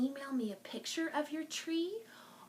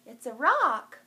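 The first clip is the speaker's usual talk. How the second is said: much louder bright announcement; excited reveal; 13 dB louder than usual. Real, not acted. Librarian showing the rock to kids, excited reveal. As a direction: proudly proclaim the find.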